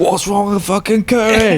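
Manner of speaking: put-on Cockney accent. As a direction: play a rowdy Cockney bloke. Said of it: "[name] goes full Cockney".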